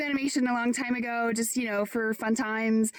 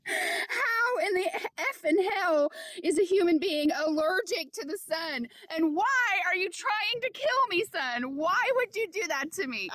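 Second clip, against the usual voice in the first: mock outrage; 6 semitones higher than usual. Real, not acted. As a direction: plead with the sun like a betrayed lover. Scolding the sun in disbelief.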